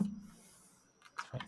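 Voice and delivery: breathless unvoiced whisper. Real, not acted. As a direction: whisper it under your breath. Repeated under breath.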